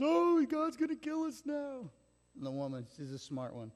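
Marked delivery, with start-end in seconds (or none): 0.0-1.9 much higher, louder mock panic; hammed-up terror at meeting God; retold panic. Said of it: "He goes".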